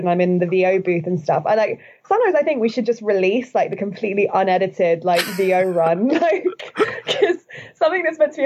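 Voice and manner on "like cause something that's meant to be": chuckling through the words; laughing. Real, not acted. Podcast guest laughing about her long voice-over sessions. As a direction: laugh as you set up the story.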